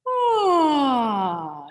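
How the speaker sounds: high open yawning sigh sliding down. Shown as a demonstration. Voice teacher showing the class a yawn sigh. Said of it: "just a yawn sigh".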